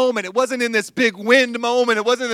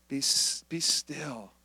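much softer, lower and hushed; 8 semitones lower, 13 dB quieter, slower than usual. Shown as a demonstration. Pastor models stillness to the congregation, hushed. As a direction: settle it down, near a whisper. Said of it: "I just gotta be quiet".

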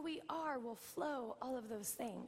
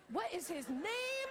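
mock protective outrage; 6 semitones higher, slower than usual. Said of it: "I'm like".

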